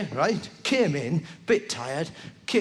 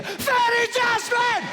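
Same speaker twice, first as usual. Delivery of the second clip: high, slow, drawn-out shout; grand shout; slower than usual. Acted. Comedian to the crowd, high slow proclaiming shout. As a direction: proclaim it like a magic spell. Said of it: "Sorry, I shout that at you".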